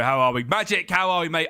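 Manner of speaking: usual speaking voice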